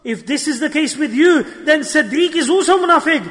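upset and worried. Retold or imitated. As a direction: act out a man alarmed for himself. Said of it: "upset and worried".

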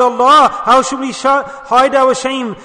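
acted panicked plea; panicked cry. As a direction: call out in alarm, dragging out the end. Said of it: "then they're running around".

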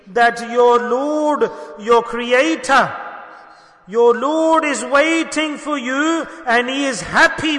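slow, loud, weighty words; slow emphatic preaching. Acted. Preacher reaching the hadith's climax, slow and emphatic. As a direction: lean on each phrase to land the climax.

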